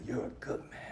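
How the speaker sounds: breathy whisper right in his ear. Retold or imitated. Mentor pulls the kid closer and whispers it. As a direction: whisper it like a secret between friends. Pulling him in closer, repeated.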